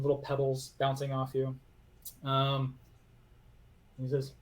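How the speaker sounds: usual speaking voice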